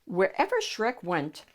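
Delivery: plain reading voice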